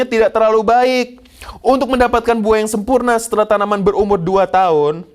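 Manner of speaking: full-force preaching. Acted. Preacher telling congregation about first fruit, loud proclamation. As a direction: proclaim it to the whole hall.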